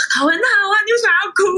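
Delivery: mock mother asking why she cries; imitating mom. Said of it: "我妈还想说".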